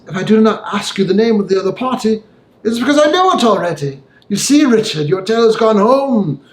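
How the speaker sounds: put-on a careful old lawyer pressing a point; the lawyer Utterson.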